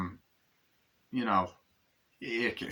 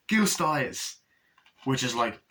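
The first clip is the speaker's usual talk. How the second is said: ordinary delivery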